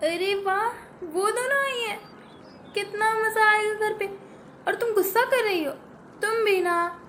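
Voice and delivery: a grown-up chuckling at the news; amused elder sister. Acted. Elder sister happy the cousins came, low and pleased.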